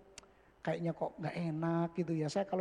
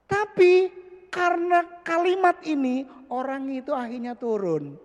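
1 excited; 8 semitones higher, 8 dB louder than usual. Real, not acted. Preaching climax.

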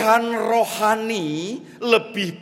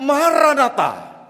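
command word; 8 semitones higher, 7 dB louder than usual. Acted. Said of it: "cukup Bapak katakan".

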